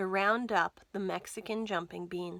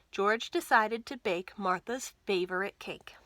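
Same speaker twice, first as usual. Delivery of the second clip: usual speaking voice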